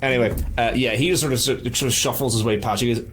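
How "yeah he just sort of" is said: usual speaking voice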